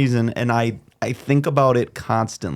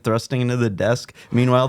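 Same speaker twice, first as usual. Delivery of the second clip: ordinary talk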